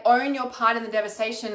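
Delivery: ordinary talk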